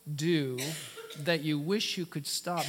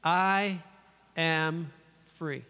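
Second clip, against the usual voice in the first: I and AM punched, free falling; declaring truth; 4 dB louder, much slower than usual. Shown as a demonstration. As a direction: proclaim "I am free" with weight on I. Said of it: "What is the truth?".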